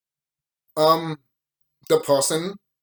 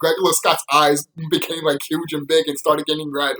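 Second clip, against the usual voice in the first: ordinary talk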